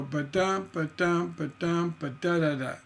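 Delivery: sung-out ba-dum rhythm syllables; rhythm demo. Shown as a demonstration. Songwriting teacher scatting a three-syllable cluster. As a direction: tap out the beat with ba-dum. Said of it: "That's a three-syllable cluster".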